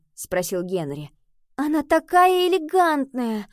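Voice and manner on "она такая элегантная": high, loud, slow gush; wistful admiration with raised pitch; sighing admiration. A narrator's reading. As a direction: sigh it out admiringly, stretched. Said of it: "вздохнула Нова".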